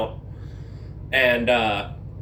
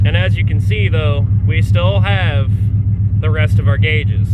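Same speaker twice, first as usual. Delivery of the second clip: raised voice over engine; 2 semitones higher, 8 dB louder than usual. Real, not acted. Speaking over the running engine.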